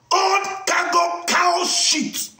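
high, slow, dragged-out insult. Retold or imitated. Mocking Susie and her friend, slow drawn-out insult. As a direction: stretch the insult out with contempt.

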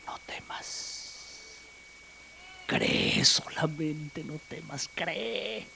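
slow, whispered reassurance; softly calm a grieving father; Jesus whispering to the father; tender whisper. Acted.

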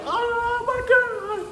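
very high, very slow hammed-up sob; mock crying girlfriend. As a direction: wail it like a sobbing girl on her knees. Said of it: "go down on their knees".

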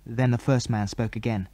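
ordinary delivery, nothing marked

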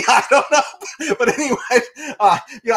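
cracking up mid-sentence; laughing. Real, not acted. Joking about feminism with co-hosts, he breaks into laughter. Laughs at his own joke.

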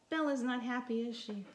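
usual speaking voice

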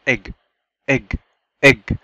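don't stretch the E in egg; short E in egg. Shown as a demonstration. Teacher showing learners the short vowel, egg with a quick E. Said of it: "فبننطقه كده على طول وبسرعة".

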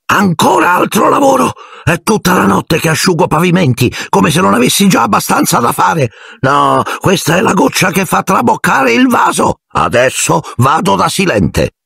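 a put-upon caretaker ranting, voice cracking high, slowing on the last threat; hysterical Filch. Acted. Caretaker raging alone about flooded floors, hysterical high rant. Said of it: "la voce isterica di Filch".

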